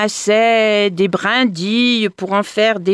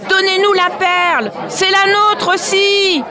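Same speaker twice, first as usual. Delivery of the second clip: demanding shout; 8 semitones higher, 5 dB louder than usual. Acted. Villagers demand the pearl.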